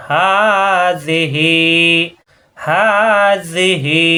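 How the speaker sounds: হাজিহি with হা held long and slow; stretched vowel. Shown as a demonstration. Teacher stretching হাজিহি for the class. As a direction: draw out the হা in হাজিহি. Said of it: "হা খাড়া জবর এক আলিফ টান".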